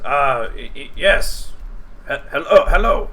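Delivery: high, nervous goblin stammer. Acted. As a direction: stammer like a jumpy little goblin. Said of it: "And he says".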